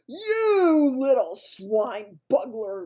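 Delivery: loud, slow furious giant roar. A narrator's reading. Bloodbottler attacks the BFG, roaring with fury. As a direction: bellow with rage. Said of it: "roared the blood bottler".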